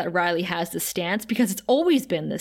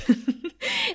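a high squealing giggle. Real, not acted. High giggle after the Willis joke.

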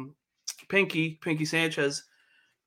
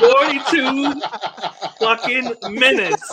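slow, loud, laughing disbelief; laughing exasperation; 8 semitones higher, 9 dB louder, slower than usual. Real, not acted. Host laughing at his co-host's long rant, loud and slow. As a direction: stretch it out, cracking up at the wait.

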